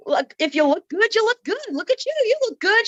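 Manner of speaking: high, excited compliment patter; hyping up. Retold or imitated. Woman caught admiring herself in the bathroom, hyping up.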